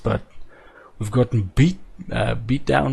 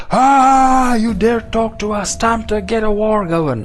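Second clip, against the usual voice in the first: angry warlord; 7 semitones higher, 4 dB louder than usual. Pretend. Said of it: "if they come back like".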